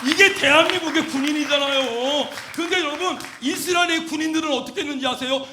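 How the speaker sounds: loud, high-pitched sermon delivery; forceful preaching. Real, not acted. Preacher rallies congregation about the nation's soldiers, forceful preaching. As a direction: drive it home to the congregation at full voice.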